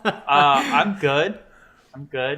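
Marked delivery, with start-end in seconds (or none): 1.9-2.4 glum, subdued reassurance; unhappy. Real, not acted. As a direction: say you're fine without sounding it. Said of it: "you don't sound happy though".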